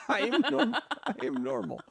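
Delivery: laughing through the words; giggling it out. Retold or imitated. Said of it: "this one is like".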